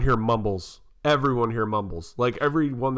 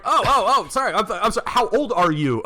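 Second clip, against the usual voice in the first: laughing apology; 7 semitones higher than usual. Retold or imitated. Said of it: "So I'm like".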